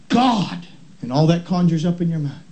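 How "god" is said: "God," punched high and set apart; stress on God. Shown as a demonstration. Preacher showing the church a pattern, stress on "God,". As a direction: lean hard on "God," then pause. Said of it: "each time emphasizing a different word".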